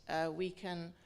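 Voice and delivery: normal talking voice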